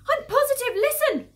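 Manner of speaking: high, loud and insistent; emphatic high-pitched yell; urgent shout. Acted. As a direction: shout back in alarm. Alarmed fox insists they are digging.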